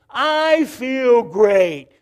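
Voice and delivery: loud, slow, emphatic I feel great; drawn out and proclaimed; said like you mean it. Shown as a demonstration. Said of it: "Say it like you mean it".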